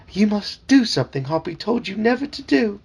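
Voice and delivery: high, fussy talking frog calling him sir; chirpy, insistent little frog servant; eager frog voice. Acted. Dad voicing the talking frog for his kids, high and eager.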